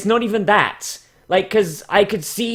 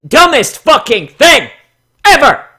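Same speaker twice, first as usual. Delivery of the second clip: loud, disbelieving yell; exasperated shout; 6 semitones higher, 8 dB louder than usual. Real, not acted. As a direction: blurt it out in real outrage. Exasperated outburst at a bad story.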